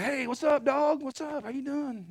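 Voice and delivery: high coaxing voice to calm an angry dog; sweet-talking a dog. Retold or imitated. Preacher to the basset hound, coaxing high voice. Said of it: "I'm like".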